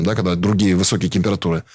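normal talking voice

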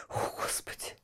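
breathless frightened whisper. Acted. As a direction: gasp it out in fear. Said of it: "в страхе прошептала мать".

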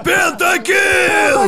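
high, drawn-out hype yell; esports caster. Retold or imitated. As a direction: scream it like a caster calling a pentakill. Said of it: "видел видосы ... комментаторов в киберспорте".

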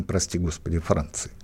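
dropped to an ironic undertone. Real, not acted. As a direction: tuck it away, softly ironic. Ironic aside about France.

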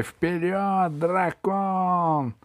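high, stretched rallying cry; shouting. A narrator's reading. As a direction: cheer the dragon on. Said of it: "разбойники закричали". (протяжный подбадривающий крик)

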